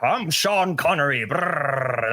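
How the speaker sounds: a hint of a purposely bad impression; deliberately bad impression with a rolled trill; Sean Connery. Host mimics a purposely bad celebrity impersonation, hammed-up impression. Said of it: "it would be purposely bad".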